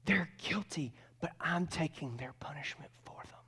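earnest whispered plea, acted out; hushed advocacy. Jesus advocating for the guilty before God, hushed and solemn. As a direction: confide it solemnly, dropping to a whisper.

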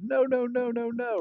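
high, rapid-fire protest; pleading Moses. Acted. Moses stopping God from wiping out the people, pleading.

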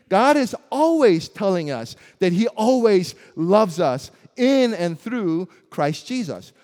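ordinary delivery

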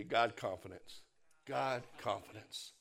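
low soft "God confidence"; quiet call and response. Shown as a demonstration. Preacher leading the congregation, low and soft. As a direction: lead the room softly. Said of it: "Say it with me".

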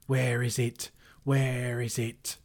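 loud, high creature cry; frantic high-pitched wail; wailing Gollum. A narrator's reading. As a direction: cry out in anguish, drawing the words out. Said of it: "Bilbo heard him crying".

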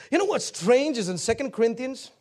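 ordinary delivery

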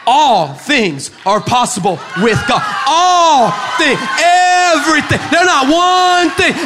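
high, loud, drawn-out shouted declaration; full-force preaching. Real, not acted. Preacher telling the church what God makes possible, shouting. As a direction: build to a roaring sermon climax.

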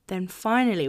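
ordinary talk, nothing marked

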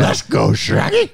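loud, pitch leaping up at the end; rough attempt at a cartoon voice; cartoon character impression. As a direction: call out in a goofy cartoon voice. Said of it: "that's better, but still kinda not the best".

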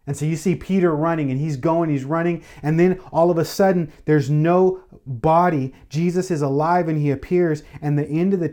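fervent preaching, pitch lifted, pressing the point; preaching at full force. Acted. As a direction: build the sermon to a joyful peak. Preacher retells the empty tomb.

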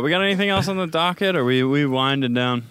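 usual speaking voice

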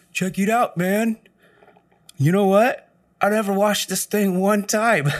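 high, loud lazy stoner dude; stoner coworker voice. Retold or imitated. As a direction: play the burned-out skater kid showing off.

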